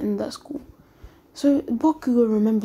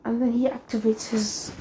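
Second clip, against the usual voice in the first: ordinary delivery, nothing marked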